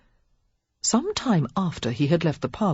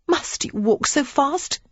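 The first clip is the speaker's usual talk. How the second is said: a slow, high, petulant complaint; demanding; 10 semitones higher, slower than usual. A narrator's reading. Lily stirs up an argument with the earl, slow and petulant. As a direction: pick a fight on purpose. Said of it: "she demanded".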